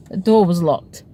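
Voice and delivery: plain reading voice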